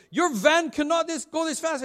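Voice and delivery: scolding policeman. Retold or imitated. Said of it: "he began to shake his finger".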